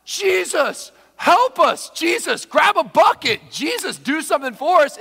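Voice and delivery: high, loud panicked shouting; acted screaming. Disciples beg Jesus for help, panicked shouts. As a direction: shout desperately for help over a storm. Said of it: "screaming at Jesus to wake up".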